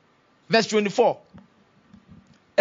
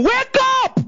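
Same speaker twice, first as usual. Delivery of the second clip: very high, loud yell; rousing shout; 6 dB louder than usual. Shown as a demonstration. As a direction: shout to jolt a sleeper awake. Rousing sleeping neighbours in congregation.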